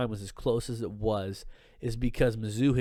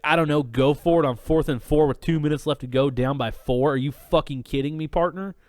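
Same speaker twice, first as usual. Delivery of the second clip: put-on louder, heated retort to the old man; retold angry comeback; 3 semitones higher, 7 dB louder than usual. Arguing with the old man at the game, heated. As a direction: throw it back in his face, incredulous. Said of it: "It's like".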